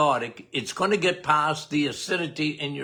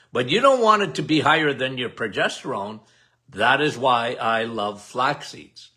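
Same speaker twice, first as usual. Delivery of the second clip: usual speaking voice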